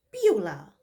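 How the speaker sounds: loud startled cry of the name; startled shout. Acted. Corinne catching the maid in her kitchen, shouting. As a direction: blurt the name out in shock. Corinne discovers intruder Beulah.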